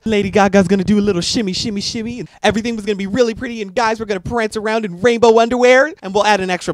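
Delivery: mock-gushing about a pretty video; mocking excitement. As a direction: gush like a hyped-up fan. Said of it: "will go a little something like this".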